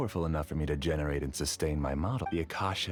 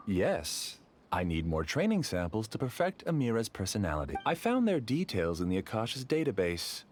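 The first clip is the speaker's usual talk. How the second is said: usual speaking voice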